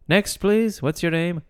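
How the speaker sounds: high-pitched mock clerk calling the queue; DMV clerk. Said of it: "can you imagine at the D M V?".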